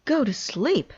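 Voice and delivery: high, loud excited shout; high delighted outburst. A narrator's reading. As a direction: burst out as you remember. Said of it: "shouted Toad".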